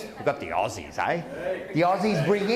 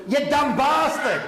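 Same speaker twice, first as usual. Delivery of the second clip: slow, loud and higher, laughing through it; mock shouted insult; 6 semitones higher, 4 dB louder than usual. Comedian imitating his dad yelling at him, mock shout. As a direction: bellow the dad's insult with a laugh. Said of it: "And me".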